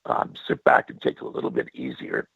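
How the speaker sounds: hoarse, worn-out voice; losing voice. Real, not acted. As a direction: voice nearly gone after singing all night. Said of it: "Sorry, I'm losing my voice".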